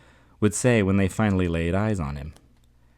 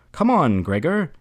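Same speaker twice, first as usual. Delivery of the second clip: loud, high cheer of encouragement; cheering shout; 8 semitones higher, 6 dB louder than usual. A narrator's reading. Family cheers Gregor on at the lock, shouting. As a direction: cheer him on in a raised, lifted voice. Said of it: "they should have shouted".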